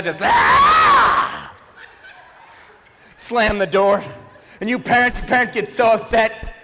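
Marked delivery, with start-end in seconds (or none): 0.2-1.5 high piercing anime battle scream; Dragon Ball Z yell. Retold or imitated. Kid ends fight with parents, high anime battle scream. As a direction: let out a huge powered-up anime yell. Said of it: "this Dragon Ball Z yell".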